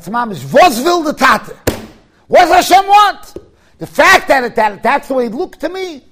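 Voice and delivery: loud, high preaching burst; fiery preaching. Acted. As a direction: hammer the lesson home to the crowd. Preacher driving his point.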